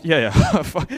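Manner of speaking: ordinary talk, nothing marked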